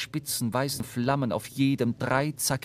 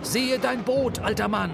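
shouting; 10 semitones higher than usual. A narrator's reading. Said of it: "schrie Starbuck".

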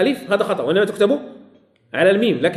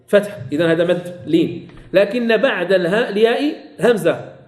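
normal talking voice

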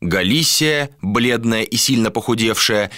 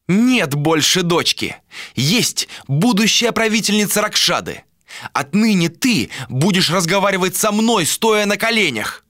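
haughty tone; 10 semitones higher than usual. A narrator's reading. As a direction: look down on him as a future queen. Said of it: "произнесла надменным тоном". (надменно, свысока)

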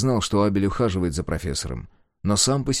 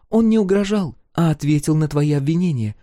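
put-on higher, firm young woman; 8 semitones higher, 5 dB louder than usual. A narrator's reading. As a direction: calmly shut the quarrel down. Sol voiced, firm.

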